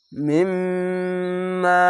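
মিম্মা chanted very slowly with the doubled m held nasally; nasal ghunna. Shown as a demonstration. Teacher shows the obligatory ghunna, slow nasal chant. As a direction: hum through the m of মিম্মা. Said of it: "এখানে ওয়াজিব গুন্না".

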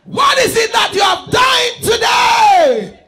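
loud, rising sermon climax; loud preaching over the music; preaching at full force. Acted. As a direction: drive the congregation to praise.

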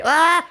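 sudden high-pitched cry; startled scream. Real, not acted. Gamer startled by the car in the game, sudden high scream. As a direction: yelp out in alarm. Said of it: "Excusez-moi pour ce cri".

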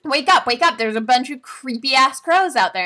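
high, loud urgent call; urgent wake-up. Retold or imitated. Girl waking the caravan leader, loud and urgent. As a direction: rouse him in a hurry. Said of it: "waking leader about crows".

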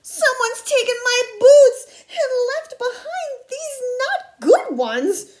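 high, loud squeaky whine; squeaky mock-crying tantrum, slowing at the end; upset Baby Bear. A narrator's reading. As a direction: wail like a little cub in tears. Baby Bear upset over boots.

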